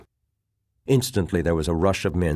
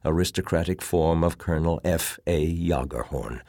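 ordinary delivery, nothing marked

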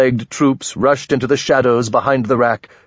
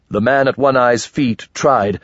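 ordinary delivery, nothing marked

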